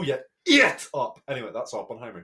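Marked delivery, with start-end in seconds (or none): 0.4-1.2 calls "Eat up!" out loud and high; loud cheer. Real, not acted. Hyping up the film with her co-host, loud cheer. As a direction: cheer it like a fan.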